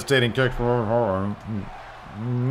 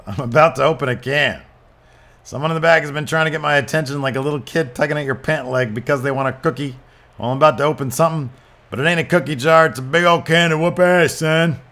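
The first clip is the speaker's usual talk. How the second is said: cocky trash talk, somewhat higher and louder toward the end; wrestler promo; 5 semitones higher, 5 dB louder, faster than usual. Acted. Finn Balor threatening someone in the back, hammed-up promo. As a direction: threaten like a heel building up.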